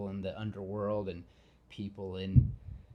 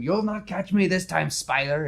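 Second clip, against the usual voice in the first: a bad, hammed-up Russian accent, higher and louder; terrible Russian accent; 8 semitones higher, 7 dB louder than usual. Rhino threatening Spider-Man in a hammed-up bad Russian accent. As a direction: play the Russian villain badly on purpose. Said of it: "that was terrible Russian".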